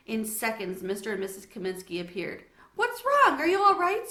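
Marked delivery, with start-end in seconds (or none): 2.8-4.1 alarmed, higher and louder; worried father. Acted. Father runs in to daughter, alarmed and raised. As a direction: rush in worried. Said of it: "Sophie's father asked".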